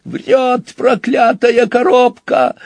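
very high, drawn-out yelp; wailing outcry. A narrator's reading. Bulmont denounces the machine, wailing. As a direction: howl the accusation at a high pitch. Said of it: "завопил Бульмонт".

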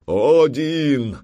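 протяжный, высокий и громкий возглас; воинственный выкрик во весь голос; боевой клич. A narrator's reading. War cry amid battle. (протяжный боевой клич)

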